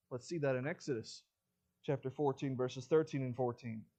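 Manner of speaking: usual speaking voice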